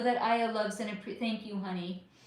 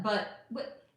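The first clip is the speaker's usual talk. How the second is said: ordinary talk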